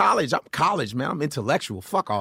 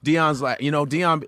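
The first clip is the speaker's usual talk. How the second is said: ordinary talk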